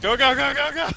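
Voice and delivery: high excited yell; yelling while running away. Real, not acted. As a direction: cheer a teammate on, loud. Urging a breakaway run.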